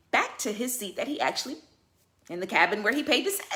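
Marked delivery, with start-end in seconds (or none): none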